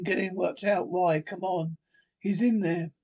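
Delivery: ordinary talk, nothing marked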